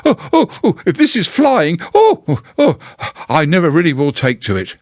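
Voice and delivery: high, jolted squeaky Piglet voice; jerky Piglet. Acted. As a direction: bounce the words like a bumpy ride. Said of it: "thought jerkily to himself".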